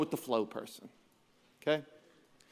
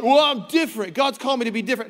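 higher, louder mock-pious voice; mock the self-righteous excuse; smug self-justification; 5 semitones higher, 5 dB louder, faster than usual. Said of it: "You can't be like".

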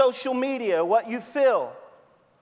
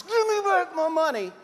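mock demand; 9 semitones higher than usual. Servant choking his debtor.

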